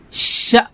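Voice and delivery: শা with a hard burst of breath; pushed শা. Shown as a demonstration. Teacher demonstrates a forceful শা. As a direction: push the শা out. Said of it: "শা এভাবে ধাক্কা দিয়ে বলতে হবে".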